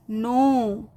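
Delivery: नो with a long, drawn-out O; long vowel. Shown as a demonstration. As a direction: stretch the O in नो slowly. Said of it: "vowel का sound long होगा". (नो में ओ की लंबी, खिंची आवाज़)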